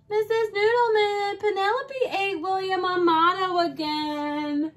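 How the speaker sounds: high sing-song tattletale; tattling child. Acted. Child tattles to Mrs Noodleman, sing-song whine. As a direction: tattle to the teacher in a whiny chant.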